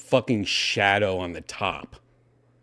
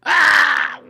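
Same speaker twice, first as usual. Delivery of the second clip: sudden high yelp; startled yell; 7 dB louder than usual. Real, not acted. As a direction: yelp in surprise. Sudden shout.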